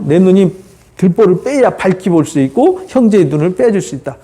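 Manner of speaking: ordinary talk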